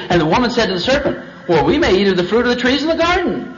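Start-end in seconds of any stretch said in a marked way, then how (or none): 1.5-3.5 high, confident reply as Eve; positive. Acted. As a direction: answer with upbeat certainty, pitch lifted. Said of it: "See, she was positive".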